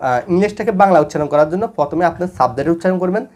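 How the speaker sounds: ordinary talk, nothing marked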